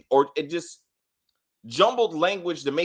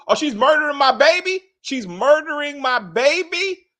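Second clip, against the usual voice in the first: high, loud hammed-up disbelief; mock outraged outburst; 9 semitones higher, 5 dB louder than usual. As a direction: wail it in exaggerated shock, loud and high. Acting out Junior's dramatic outburst.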